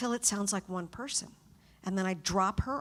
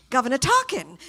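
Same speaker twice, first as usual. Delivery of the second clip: mock-grand English accent, higher and louder; affected English accent; 3 semitones higher, 8 dB louder than usual. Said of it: "that really weird affected English accent".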